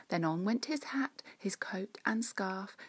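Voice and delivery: plain reading voice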